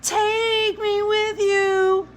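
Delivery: put-on high, slow child's wail; drawn-out childish howl; howling little boy. A narrator's reading. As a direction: wail it like a small boy begging. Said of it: "Jamie began to howl".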